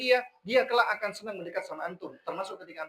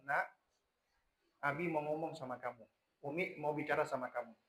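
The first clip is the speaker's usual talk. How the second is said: much softer, gentle parent voice; calm and loving; 6 semitones lower, 9 dB quieter than usual. Retold or imitated. Father gently opening a talk with his child, soft and tender. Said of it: "suara yang tenang dan penuh kasih".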